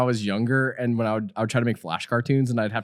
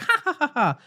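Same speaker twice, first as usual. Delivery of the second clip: high, hammy villain cackle; evil laugh; 13 semitones higher than usual. Acted. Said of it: "I started to do, like, a laugh".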